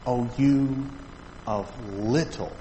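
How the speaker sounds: slow, hushed reproach with long pauses; solemn gentle rebuke. Acted. Jesus to Peter as he lifts him, slow soft rebuke. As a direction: let each phrase sink in, softly.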